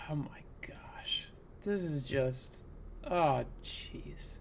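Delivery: slow, voiceless disbelief; stunned whisper. Real, not acted. Player watching the game slip away, whispering stunned. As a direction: exhale the words, barely voiced.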